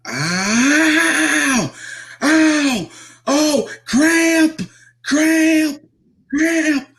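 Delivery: very high, drawn-out howls; imitated screaming in pain. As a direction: scream through a leg cramp, hammed up as mimicry. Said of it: "he's screaming at the top".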